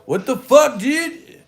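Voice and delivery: high, loud whiny yell; bratty screech. Retold or imitated. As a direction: scream at mom like a spoiled teen. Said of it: "talking to his mom crazy like that".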